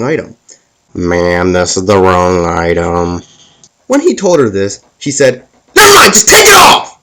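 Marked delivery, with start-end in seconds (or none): 0.9-3.2 low, slow, deadpan cashier voice; patient cashier. Retold or imitated. Cashier corrects customer. 5.7-7.0 high, loud, snapped mock shout; hammed-up irritated customer; angry customer. Angry customer snaps at cashier.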